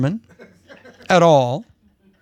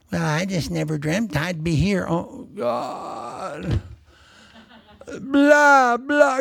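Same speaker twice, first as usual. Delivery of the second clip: mock lament; 3 semitones higher, much slower than usual. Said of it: "I'm not mocking".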